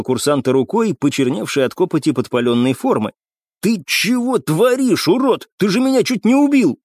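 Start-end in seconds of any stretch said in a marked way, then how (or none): 3.6-6.8 high-pitched, outraged scolding; angry rebuke. A narrator's reading. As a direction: lay into him, pitch raised. Struck cadet is berated by ranger.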